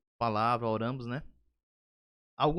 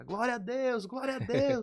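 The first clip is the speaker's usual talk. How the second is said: high, loud cry of praise, imitating her; grito de louvor imitado; 11 semitones higher, 5 dB louder, slower than usual. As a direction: shout it out high like an overjoyed worshipper. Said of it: "começou a gritar". (grito agudo de louvor, imitado)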